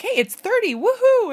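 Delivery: high, loud cheer over the warm weather; mock cheer. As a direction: whoop it up like thirty is summer. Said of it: "you're like".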